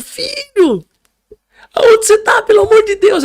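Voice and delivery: very high, loud pleading cry; screamed, high-pitched plea; desperate mother shouting. Retold or imitated. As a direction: imitate a frantic mother on the phone. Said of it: "Aqueles gritos que ela dava".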